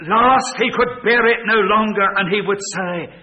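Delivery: loud, high, driving pulpit voice; thundering sermon delivery; full-force preaching. Acted. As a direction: preach it out to the back row.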